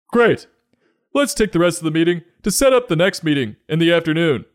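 very high, loud, fast cheery manager; hammed-up corporate manager; upbeat boss. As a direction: wrap up the call with fake cheer. Boss on conference call.

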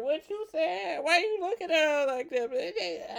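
high, sharp mock jealous girlfriend. Comic acting out a jealous girlfriend confronting him, high-pitched. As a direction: play the jealous girl grabbing his shirt. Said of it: "Then you grab us by the shirt".

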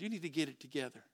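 low, soft, quick blunt aside; blunt friend's rebuke. Acted. Church friend bluntly correcting a sister, low and understated. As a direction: deliver the rebuke quietly, dropping low. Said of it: "I would never talk like that".

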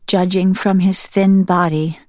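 usual speaking voice

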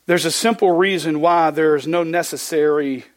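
normal talking voice

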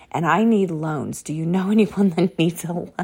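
quoted line breaking into a laugh; laughing through quote. Retold or imitated. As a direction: laugh as you deliver the lucky news. Quoting client, laughing.

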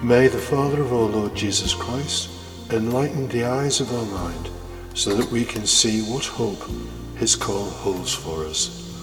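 normal talking voice

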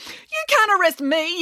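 high, indignant yelling. A narrator's reading. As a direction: yell back in outraged protest. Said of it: "yelled Daisy".

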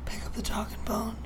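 soft whisper, working it out; barely voiced, trying out unfamiliar slang; whispered repeat. Real, not acted. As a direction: whisper it to yourself as you puzzle.

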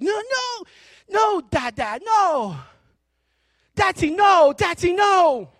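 put-on high, loud toddler protest; giggling little child. Little son squirming from dad's hug, playful high protest. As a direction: imitate a small child squealing in play. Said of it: "he's laughing and he's giggling".

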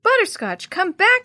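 high, loud call to come back; shouting. A narrator's reading. Jenny to her fleeing cat, shouting. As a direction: shout after the runaway pet. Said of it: "she shouted".

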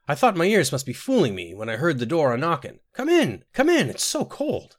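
flustered, welcoming fuss rising high. A narrator's reading. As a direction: usher them in, all aflutter and high-pitched. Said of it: "all afluster".

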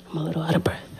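breathless whisper, barely voiced; soft out-of-breath whisper. Real, not acted. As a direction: whisper it as if winded.

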